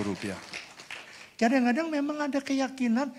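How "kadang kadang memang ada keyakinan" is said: much higher, loud climax of the sermon; preaching at full force. Acted. Pastor preaches to the congregation at full, high force. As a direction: drive the point home to the congregation.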